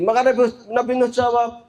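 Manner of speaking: ordinary talk, nothing marked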